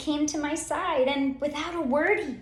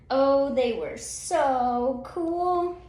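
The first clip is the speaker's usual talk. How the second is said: mock awe; 3 semitones higher, much slower than usual. A narrator's reading. Narrator gushing about cool beans.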